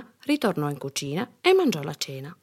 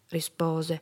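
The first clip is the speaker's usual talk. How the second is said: plain reading voice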